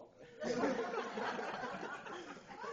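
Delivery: a high airy laugh at his own punchline; breathy laugh. Real, not acted. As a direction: let an unvoiced giggle burst out.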